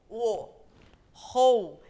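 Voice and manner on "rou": Rou punched loud, the o strong and the u gliding; a sílaba Rou bem forte; stressed syllable. Shown as a demonstration. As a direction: hit Rou hard. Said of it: "o mais forte é o ro".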